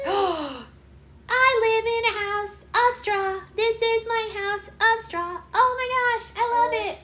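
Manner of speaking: high, slow, loud piggy voice; little piggy. Pretend. Mother plays piggy in the straw house, delighted. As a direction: squeak it out as a delighted little pig. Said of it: "Here's a piggy".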